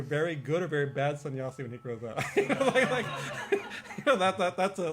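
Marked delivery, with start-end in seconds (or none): 2.4-4.9 high, loud words through laughter; amused, fighting laughter; laughing. Real, not acted. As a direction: keep talking while cracking up. Laughing while speaking.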